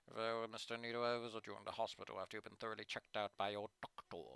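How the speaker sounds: low, soft official voice; a calm school official, voiced low and quiet; stern administrator. [name] relents.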